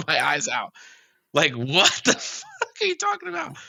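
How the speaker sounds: high, incredulous words breaking into laughter; laughing disbelief. Real, not acted. Storyteller recalling his tearful welcome home, laughing in disbelief. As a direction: laugh helplessly through the retold outburst.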